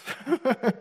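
a quick high chuckle; short high laugh. Real, not acted. Amused at everything going nuts, high laugh.

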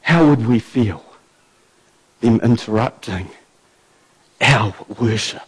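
low, soft, intimate sermon voice; quiet earnest preaching. Acted. Preacher challenging the church about proper praise, low and hushed. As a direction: draw the congregation in, hushed and sincere.

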